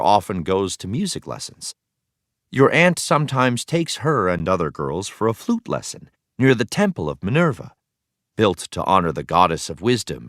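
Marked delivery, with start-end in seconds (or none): none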